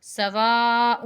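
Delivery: سواء with a loud, lengthened waa; stretched madd. Shown as a demonstration. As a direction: hold the waa in سواء before the short ending. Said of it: "اسے کھینچنا ہے".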